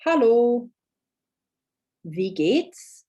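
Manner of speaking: slow, careful reading of a German text; slow model reading. Shown as a demonstration. Teacher reading the worksheet paragraph, slow and deliberate. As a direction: read each sentence slowly for learners to copy.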